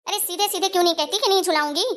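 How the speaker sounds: ordinary talk, nothing marked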